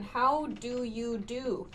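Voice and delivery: slow, prim how do you do; formal greeting. Shown as a demonstration. Teacher shows how formal the greeting sounds. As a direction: say it slow and proper, lightly stiff. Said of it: "very formal sounding".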